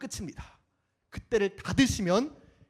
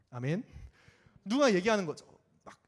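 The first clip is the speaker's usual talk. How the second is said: usual speaking voice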